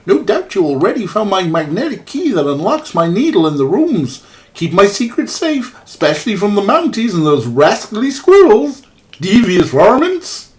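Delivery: put-on jolly, overdone Canadian accent; bad Canadian accent. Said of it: "I'm trying to do a very bad Canadian accent".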